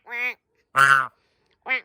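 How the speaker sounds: ordinary talk, nothing marked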